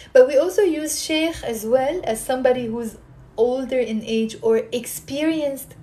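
ordinary delivery

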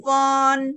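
FON held high and level; long even high "Fon"; first tone. Shown as a demonstration. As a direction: sustain "Fon" without moving the pitch. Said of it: "satu ya".

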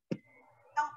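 ordinary delivery

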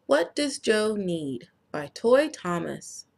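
plain reading voice

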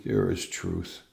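normal talking voice

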